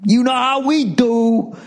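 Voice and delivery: high, slow bro drawl; mock bro voice. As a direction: play a smug dude bro. Imitating bros.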